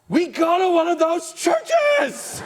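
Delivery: high, slow, delighted cry of discovery; thrilled squeal of realization; gasping excited shout. Retold or imitated. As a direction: shriek it out with a gasp of recognition. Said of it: "And she says".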